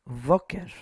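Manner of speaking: "Voker" with the stress on the opening "Vo"; stressed syllable. Shown as a demonstration. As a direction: punch the first syllable of "Voker". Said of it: "Emphasis is here on the".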